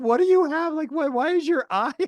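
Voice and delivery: high, anxious questioning voice of the wife; worried wife. Retold or imitated. Wife asks about injury.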